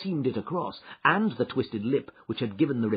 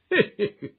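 inspector's chuckle; 13 semitones higher, 7 dB louder than usual. A narrator's reading. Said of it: "chuckled the inspector".

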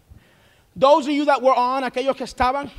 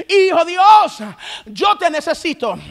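normal talking voice

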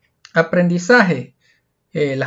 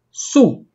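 "su" punched louder and higher as the strong syllable; stressed syllable; 6 semitones higher, 3 dB louder than usual. Shown as a demonstration. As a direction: hit "su" hard on its own. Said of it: "la sílaba que lleva mayor fuerza es".

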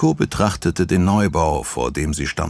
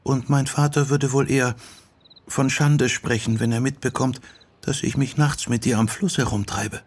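put-on higher girlish voice; Sachiko, young girl; 7 semitones higher than usual. Sachiko tells Yoko what father would think, girlish. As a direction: talk as a young girl confiding.

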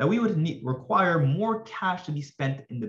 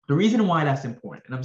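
strained voice starting to give out; hoarse voice; 3 semitones higher, 5 dB louder than usual. Real, not acted. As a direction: push through a hoarse, tired voice. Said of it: "losing my voice a little bit".

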